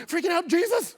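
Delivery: high, loud desperate plea; panicked prayer. Retold or imitated. Pastor turning his freak-out into a frantic prayer. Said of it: "instead of just freaking out".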